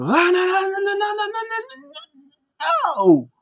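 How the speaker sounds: very high, wailing string of no's; hammed-up screech, ending in a loud burst; mock screamed protest. Mimicking Cedric as they're about to leave, screeched protest.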